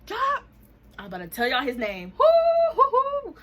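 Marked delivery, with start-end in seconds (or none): 0.0-0.4 high, loud shout of a name; calling at a door. Retold or imitated. Roommate banging on his door, high loud yell. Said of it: "banged on his door". 2.2-3.3 whooped "hoo, hoo, hoo"; high whoops after a near slip; high loud hooting. Real, not acted. About to tell y'all his name, high loud hooting.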